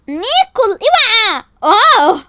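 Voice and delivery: high, loud yelp of warning; alarmed cry. Acted. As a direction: cry out at a collision. Bumping into someone, cries out.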